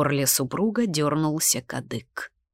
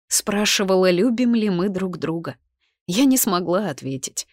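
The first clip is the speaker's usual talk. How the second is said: ordinary delivery, nothing marked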